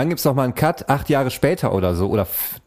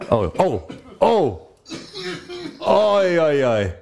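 choking; 7 semitones higher, much slower than usual. Real, not acted. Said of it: "Ich hab mich verschluckt".

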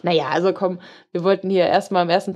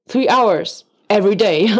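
imitated hostile reply, louder and emphatic; mock-angry woman answering in English; böse Antwort nachgemacht; 5 dB louder than usual. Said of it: "Und dann meinte sie so". (böse und nachdrücklich nachgeäfft)

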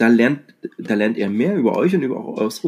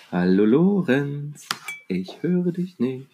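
slow, drawn-out calling over a bad line; to someone hard of hearing; slower than usual. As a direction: stretch every word so he can hear. Calling to absent Lorenz on call.

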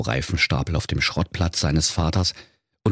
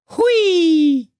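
long, high, louder "whee"; 6 dB louder than usual. Acted. Dwarf ghost flies off with the miser, gleeful whoop. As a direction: whoop like a dwarf taking flight. Dwarf cheers while taking off.

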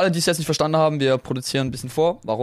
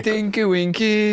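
teasing high voice; 8 semitones higher, slower than usual. Pretend. Teasing made-up Teletubby name.

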